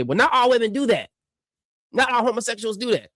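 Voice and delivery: a high, lightly voiced mock objection; mock objector. Streamer imitating critics' predictable retort, lightly in a high mock voice. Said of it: "you're gonna say".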